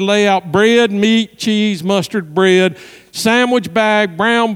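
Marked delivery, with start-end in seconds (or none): none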